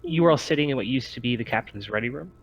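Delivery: ordinary talk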